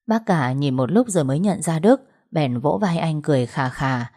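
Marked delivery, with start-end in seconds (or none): none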